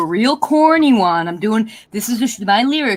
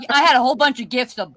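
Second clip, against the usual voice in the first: normal talking voice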